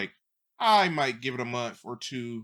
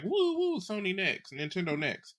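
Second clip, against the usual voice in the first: higher-pitched hyped whooping, easing down; retold excited cheering; 8 semitones higher, slower than usual. Co-host recalling watching publishers drop videos, mock-excited cheering. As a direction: imitate an excited crowd, pitch falling from the first whoop.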